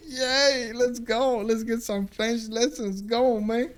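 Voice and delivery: a loud, high woohoo that settles into happy cheering; overjoyed cheer. Retold or imitated. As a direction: relive the joy of lessons starting again, cheering loudly. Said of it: "And I was like".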